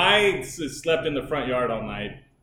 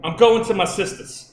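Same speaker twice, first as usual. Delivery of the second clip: put-on higher, louder Boston girl accent; mock Boston accent; thick Boston accent; 6 semitones higher, 5 dB louder than usual. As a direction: do a caricatured Boston accent, loud. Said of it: "Boston girl's mouth when it's that thick accent".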